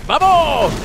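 rallying cry. Real, not acted. Player leading friends into the attack, rallying cry. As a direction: call the gang to charge.

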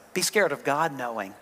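quiet, grave reminder; suddenly serious. Real, not acted. Joking, then turning serious.

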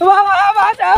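hammed-up wailing plea; crying. Devotee begging baba to save him, crying. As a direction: wail for help, drawing out the vowels. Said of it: "কাঁদছো কেন".

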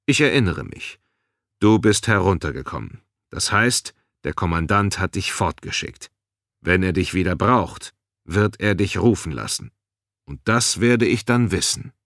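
ordinary delivery

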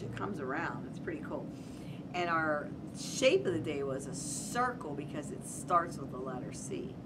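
ordinary delivery, nothing marked

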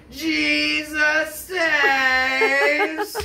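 stretched high yell with a laugh breaking through; laughing mock scream from far off; distant preacher scream. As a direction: wail it out like the street preacher again, half laughing. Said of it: "we hear".

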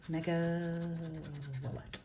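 ordinary talk

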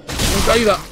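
loud, high call; excited shout. Real, not acted. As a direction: shout it mid-attack. Falling attack in game.

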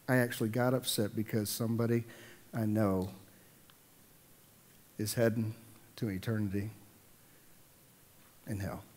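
ordinary delivery

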